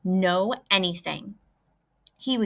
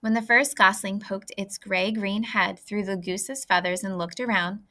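usual speaking voice

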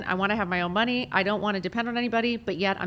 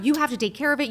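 higher and louder, pressing the demand; the first, most desperate repeat; frantic insisting, raised voice; mock frantic plea; 6 semitones higher, 3 dB louder, faster than usual. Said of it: "screaming at the top of her lungs".

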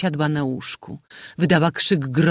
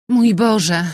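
put-on louder, higher, petulant girl's cry; spoilt daughter; 7 semitones higher, 7 dB louder than usual. A narrator's reading. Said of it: "mówiła Mojna".

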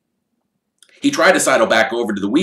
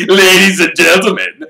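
booming showman address, higher; grand call to the crowd; loud announcement; 11 semitones higher, 10 dB louder than usual. Acted. Repeated address.

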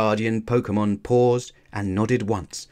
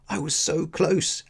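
higher-pitched complaint; complaining; 8 semitones higher than usual. A narrator's reading. Ash after narrowly losing the race, complaining. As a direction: protest a narrow loss. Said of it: "Ash complained".

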